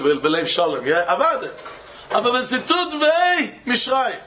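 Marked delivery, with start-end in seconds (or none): 2.1-4.2 higher punchline. Retold or imitated. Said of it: "This is what she told him".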